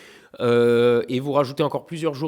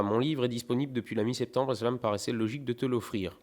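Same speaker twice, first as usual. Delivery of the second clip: normal talking voice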